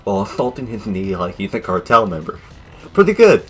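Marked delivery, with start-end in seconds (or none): 2.9-3.5 high, loud mock praise; sarcastic. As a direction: squeak it out sarcastically. Sarcastic verdict, much higher.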